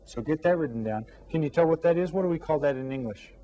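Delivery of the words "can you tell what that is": ordinary delivery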